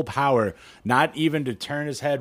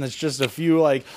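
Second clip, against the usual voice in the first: ordinary talk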